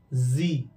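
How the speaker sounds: जी as "zee", low and falling; buzzing Z. Shown as a demonstration. Teacher repeating for learners, buzzing Z. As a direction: say जी with a vibrating z onset. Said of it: "this vibration".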